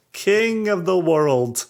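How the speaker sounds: high, slow, grand proclamation; mock triumph. Teasing repeat of friend's triumph.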